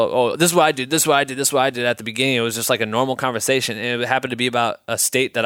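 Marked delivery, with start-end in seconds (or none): 0.0-1.8 mock bragging. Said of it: "swing our dicks around and tell".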